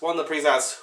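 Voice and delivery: ordinary talk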